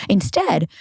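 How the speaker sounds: normal talking voice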